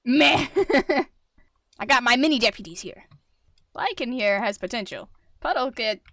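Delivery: ordinary delivery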